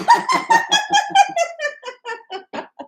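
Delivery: loud high giggle; high burst of laughter. Real, not acted. Seller laughing at her own box joke, high and loud.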